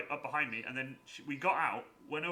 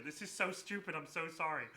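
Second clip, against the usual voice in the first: usual speaking voice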